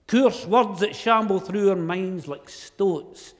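loud, slow Scots poem recital. Acted.

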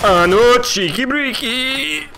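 high excited squeal of a gamer catchphrase; gleeful catchphrase. Real, not acted. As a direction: yelp it out, high and loud. Repeats catchphrase with gusto.

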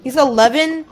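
high, loud incredulous question; shocked disbelief. Real, not acted. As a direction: blurt it out in total disbelief. Shocked reaction to age news.

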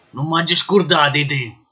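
ordinary talk

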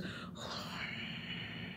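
long, quiet imitated huff of breath; mock upset exhale; upset sigh. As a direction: let out a long exasperated breath. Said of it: "whenever he gets upset, he goes".

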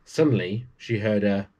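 ordinary delivery

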